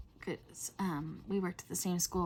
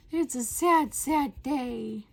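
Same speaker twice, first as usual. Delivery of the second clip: higher, louder mock wail; hammed-up wail; 5 semitones higher, 7 dB louder, much slower than usual. Vlogger wailing in mock despair about bugs. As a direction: ham up the despair, sad doubled.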